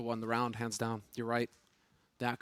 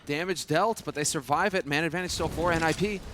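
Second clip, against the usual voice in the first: loud, hyped play-by-play call; esports caster; 4 semitones higher, 6 dB louder than usual. Real, not acted. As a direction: call the fight live, building excitement. Live play-by-play of the round.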